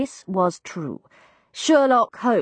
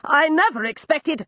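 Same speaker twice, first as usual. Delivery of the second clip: high protest; 9 semitones higher than usual. A narrator's reading. Rodney's protest continues.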